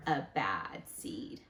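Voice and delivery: low, slow, hushed whisper; spooky whisper. Acted. Teacher summing up to the class, slow spooky whisper. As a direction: let it sink in with a whisper.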